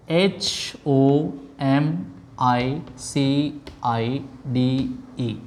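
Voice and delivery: slow letter-by-letter spelling. Shown as a demonstration. Teacher spelling the first word for students, slow and spaced. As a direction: spell it out slowly, each letter on its own.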